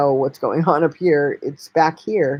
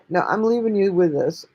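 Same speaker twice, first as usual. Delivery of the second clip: ordinary talk, nothing marked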